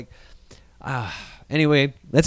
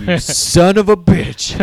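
mock angry yell; 4 semitones higher, 4 dB louder, slower than usual. Yelling at Kylo Ren.

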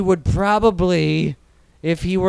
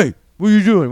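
put-on louder, barking usher voice calling out the kids; mock usher shout; 4 semitones higher, 8 dB louder, faster than usual. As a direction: catch the sneaking kids loudly, like a cranky usher. Retold usher catching sneaking kids.

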